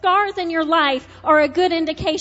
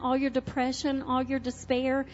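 lower, soft, tender invitation; Jesus speaking tenderly; 3 semitones lower, 7 dB quieter than usual. Acted. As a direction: offer healing gently and softly. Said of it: "Jesus wants to say to you".

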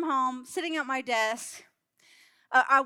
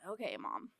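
put-on meek kids; 5 semitones lower, 12 dB quieter than usual. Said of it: "And they're just like".

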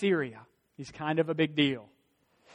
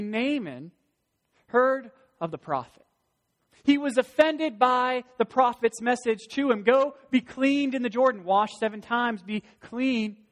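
preaching that climbs louder and higher; building preaching; 6 semitones higher, 5 dB louder than usual. Acted. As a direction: drive it up to full pulpit force. Preaching builds to full force.

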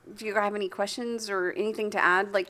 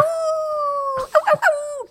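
high yelping ows; mock pain cries; 6 dB louder, slower than usual. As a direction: yelp like you got tackled.

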